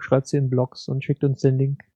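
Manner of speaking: ordinary talk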